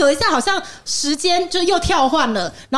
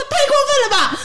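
indignant outcry; 10 semitones higher than usual. Retold or imitated. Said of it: "然后我就说".

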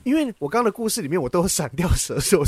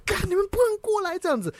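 mock alarm; 13 semitones higher, 3 dB quieter than usual. Shouting at pets in dream.

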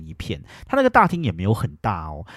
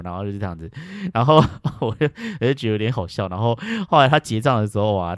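ordinary talk, nothing marked

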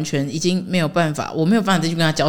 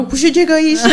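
laughing; 9 semitones higher than usual. Real, not acted. Imagined American reaction, laughing.

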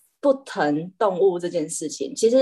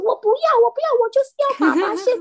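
much higher, louder and fast, a bratty whine; spoiled cat tantrum; 5 dB louder, faster than usual. Retold or imitated. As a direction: throw a cat's tantrum, demanding daddy. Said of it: "他说". (任性撒泼的猫咪，又高又快)

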